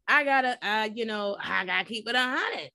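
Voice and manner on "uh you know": usual speaking voice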